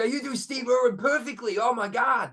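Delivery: high-pitched mock astonishment; flipped-out Americans. Host retells Americans flipping out at him, mock astonishment. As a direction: imitate fans flipping out over an impression. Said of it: "they flipped out when I started".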